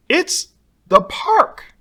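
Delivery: high, slow, bright call of arrival; excited announcement. Acted. Teacher announces the park to the bus, excited.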